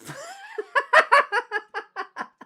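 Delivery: high, loud burst of real laughter. Real, not acted. Streamer cracking up at his own streamer impression, loud and high.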